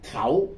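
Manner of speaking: khẩu said as the Sino-Vietnamese reading. Shown as a demonstration. Teacher showing the Vietnamese reading khẩu. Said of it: "chúng ta đọc theo âm Hán thì là".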